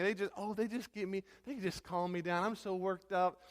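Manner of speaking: gushing infatuation. Retold or imitated. Acts out a gushing new spouse.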